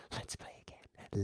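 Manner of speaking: slow whispered scheming; a sly slow whisper. Real, not acted. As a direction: breathe it conspiratorially. Whispering a rematch plan.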